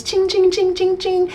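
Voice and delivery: high chiming ching sounds like jingling keys; imitated key jingle. Shown as a demonstration. Imitating the jingle of new house keys. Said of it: "I started listening to key sounds".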